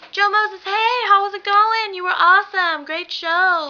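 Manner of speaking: high, drawn-out excited hello; retold starstruck fan chirp; gushing fan greeting. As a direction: gush high at your idol. Acts out greeting the performer.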